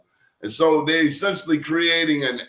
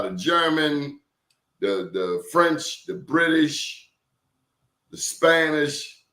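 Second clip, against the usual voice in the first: slow emphatic list; slower than usual. Real, not acted. Listing colonial powers.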